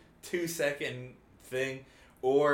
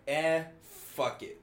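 dismissive shrug; 3 semitones higher, slower than usual. Retold or imitated. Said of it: "they're like".

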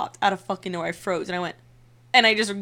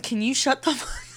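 mean snap; 5 semitones higher than usual. Retold or imitated. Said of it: "it was so mean".

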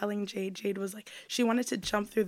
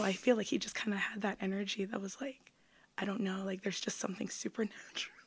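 whispering; 6 dB quieter than usual. Retold or imitated. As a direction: lean in and whisper the creepy detail. Whispered vampire date story.

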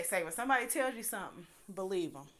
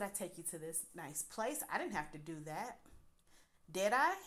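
mock-calm condescension; calm, condescending; 2 semitones lower, 4 dB quieter than usual. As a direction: quietly talk down, ending on a prodding question. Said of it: "condescending voice".

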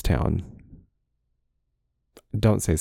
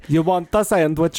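higher-pitched cartoon Italian accent; cartoonish Italian accent; 9 semitones higher than usual. Deli man taking the phone order, cartoonish Italian accent. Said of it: "Italian accent you'll ever hear".

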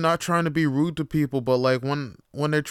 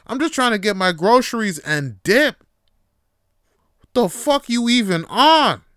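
higher, louder hammed-up threat; imagined threats at the clerk, louder and higher; mock threats; 6 semitones higher, 8 dB louder than usual.

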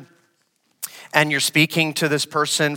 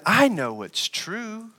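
serpent's claim; 6 semitones higher, 5 dB quieter, slower than usual. Retold or imitated. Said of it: "the serpent said".

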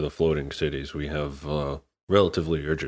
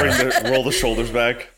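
laughing; 6 semitones higher, 6 dB louder than usual. Real, not acted. Laughing while describing flex.

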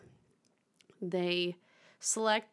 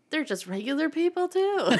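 much higher, louder mock wonder breaking into laughter; mock amazed realization; 10 semitones higher, 6 dB louder than usual. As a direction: gush in pretend astonishment, ending in a laugh. Acting out realization stars are normal.

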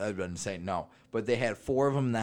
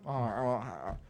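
ordinary talk, nothing marked

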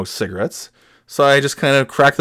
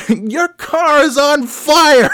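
stranger's warning; 13 semitones higher, 3 dB louder, much slower than usual. Retold or imitated. Stranger reports the fire.

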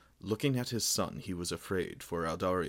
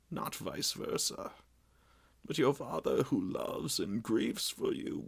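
aged king's voice pitched higher, sorrowful; king answering his angry son, higher and grieved; grieving old king; 8 semitones higher than usual. A narrator's reading. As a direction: speak as a weary, grieving father-king. Old king Meneldur speaks.

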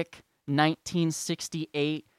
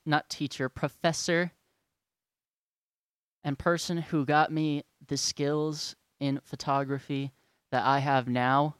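ordinary talk, nothing marked

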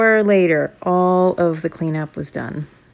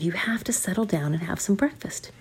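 hushed parent; 8 dB quieter than usual. Acted. Parents telling kids to settle.

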